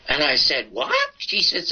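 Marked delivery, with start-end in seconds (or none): 0.7-1.1 sudden high-pitched what; squeaky surprised what; startled what. Retold or imitated. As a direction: shoot the word up in disbelief. Shocked reply to emerald news.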